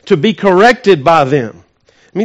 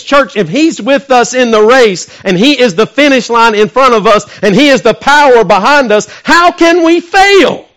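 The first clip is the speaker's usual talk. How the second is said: preaching climax; 6 semitones higher, 5 dB louder than usual. Real, not acted. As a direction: drive it home to the congregation, rising.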